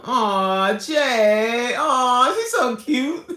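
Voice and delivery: high, loud, adoring squeal; gushing coo. Real, not acted. Streamer fawning over someone cute, gushing. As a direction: gush loudly with drawn-out, high-pitched awws.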